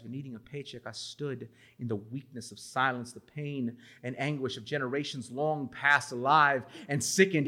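a poet reading his life story to the audience; spoken-word poem. Acted. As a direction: perform it like a slam poem, every line landing.

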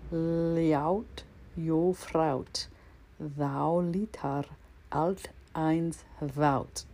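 slow, drawn-out Icelandic poetry reading; solemn Icelandic poem recital, learner's best accent. Shown as a demonstration. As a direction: savour each Icelandic line slowly, like a recital. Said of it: "So I'll read it in Icelandic again".

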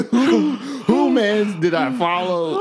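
high, loud, through fits of laughter; can barely get the words out for laughing; laughing hard. Real, not acted. Mocking the confused intruder while laughing hard. Cracking up at own joke.

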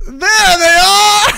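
drawn-out, loud, high cry of delight; big cheering reveal, stretched slowly; excited shout. Real, not acted. Revealing the guys on camera.

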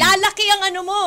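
high, loud playful hex; mock witch curse. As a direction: cast a joke spell at full voice.

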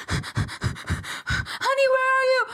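very high, panting call; frantic stepmom. Acted. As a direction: call out while running through the house. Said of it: "You have to do all the voices".

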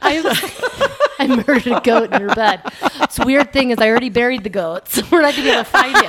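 loud mock confession broken by laughter; laughing. Joking to friends about the goat, laughing. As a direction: laugh your way through a fake murder confession.

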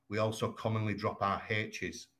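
ordinary talk

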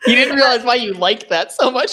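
high, laughing tease. Real, not acted. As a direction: tease her through laughter. Teasing through laughter.

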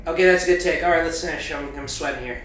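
hurried director wrapping up; rushing to end the take; impatient director. Retold or imitated. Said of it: "is just like".

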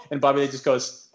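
ordinary delivery

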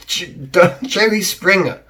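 laughing through the name, slow and high. Real, not acted. Vlogger mocking Jerry Springer, laughing out loud. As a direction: crack up at the name.

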